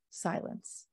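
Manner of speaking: ordinary talk, nothing marked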